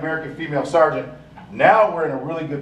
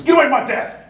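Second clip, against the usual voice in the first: much higher, much louder shout; furious order; 12 semitones higher, 6 dB louder, faster than usual. Retold or imitated. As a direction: yell him off the desk. Sergeant orders him away.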